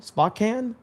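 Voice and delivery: Spokane with an open first vowel, spah-KAN; tentative pronunciation. Real, not acted. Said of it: "Is that how you say it?".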